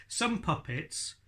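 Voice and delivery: ordinary talk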